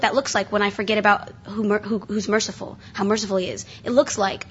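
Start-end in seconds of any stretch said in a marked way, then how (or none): none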